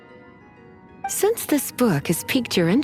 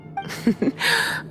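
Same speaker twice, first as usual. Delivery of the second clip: teasing chuckle. Acted. Librarian teasing companion about punishments, sly chuckle. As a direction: chuckle slyly to yourself.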